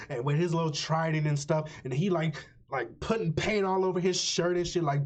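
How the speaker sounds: ordinary delivery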